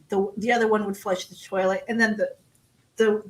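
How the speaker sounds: ordinary delivery, nothing marked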